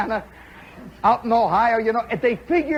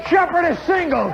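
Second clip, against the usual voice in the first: excited call; 7 semitones higher than usual. Retold or imitated. Retelling his hit, higher shouted call. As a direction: shout it like a proud play-by-play. Repeated line.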